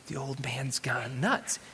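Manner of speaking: slow, low whisper; whispered inner thought. Retold or imitated. Sailor thinks to himself about the old man, whispered. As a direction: confide a startled thought under the breath.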